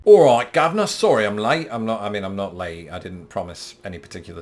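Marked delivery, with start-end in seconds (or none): 0.0-1.7 loud, hammed-up Cockney greeting; Cockney accent. Latecomer greeting the boss in a strange Cockney accent. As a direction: burst in with a put-on Cockney accent. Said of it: "I said it there in a very strange accent. It's Cockney".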